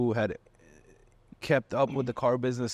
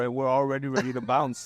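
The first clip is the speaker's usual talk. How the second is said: ordinary delivery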